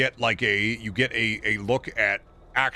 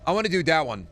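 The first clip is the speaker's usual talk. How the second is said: put-on five-year-old voice; 5 semitones higher, faster than usual. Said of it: "like a five-year-old".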